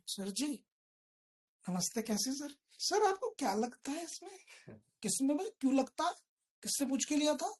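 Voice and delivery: lightly voiced caller begging for a tip; mock caller. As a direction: imitate a fawning caller on the phone. Mimics caller phoning for tips.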